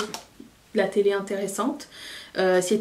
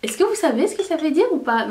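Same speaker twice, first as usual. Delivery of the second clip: incredulous retort; 7 semitones higher, 4 dB louder than usual. Retold or imitated. Her retort, retold.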